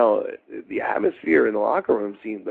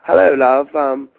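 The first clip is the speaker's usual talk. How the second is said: high, loud mock English accent; British Adrian impression; 6 semitones higher, 9 dB louder than usual. Adrian Street greeting a Baltimore rookie, high mock British voice. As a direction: camp English wrestler greeting. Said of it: "he's like".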